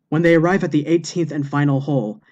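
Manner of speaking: ordinary delivery, nothing marked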